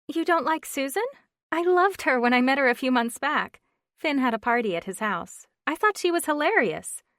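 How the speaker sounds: lightly voiced chatty friend; flustered friend on the phone; Stephanie, chatty friend.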